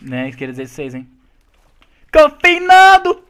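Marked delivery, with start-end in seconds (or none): 2.1-3.2 slow, stretched high yell; drawn-out taunting call; long drawn-out shout. Real, not acted. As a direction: stretch the name out loud. Calling out to enemy player.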